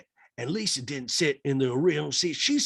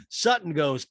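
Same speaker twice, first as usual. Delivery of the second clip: ordinary talk, nothing marked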